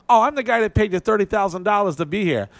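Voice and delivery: high, bossy little older woman, with a hint of an accent; Italian little lady. Older Italian promoter bossing the comic, high and pushy. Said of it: "She goes".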